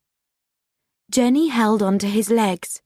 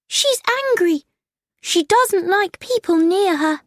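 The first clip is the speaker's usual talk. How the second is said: high-pitched alarmed voice; frightened cry; 10 semitones higher than usual. A narrator's reading. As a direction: warn them in a high, panicky voice. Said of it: "cried Jenny".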